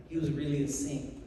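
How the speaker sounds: ordinary delivery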